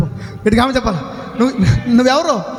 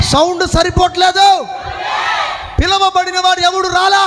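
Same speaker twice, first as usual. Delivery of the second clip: raised, higher preacher's call to the crowd; fiery preaching; 5 semitones higher than usual. Acted. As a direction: preach it out with full force to the congregation. Preaching at full force.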